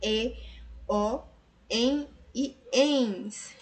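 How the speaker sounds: 2 spelling out the endings very slowly; 3 lay out each ending slowly for students; 1 slow spelled endings. Shown as a demonstration. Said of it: "quando terminam em A". (4 soletrando as terminações bem devagar)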